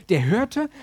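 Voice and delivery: ordinary talk, nothing marked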